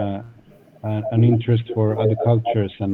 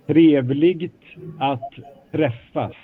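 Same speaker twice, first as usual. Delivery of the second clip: slow demo; slower than usual. Shown as a demonstration. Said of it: "Okay, once, one more time".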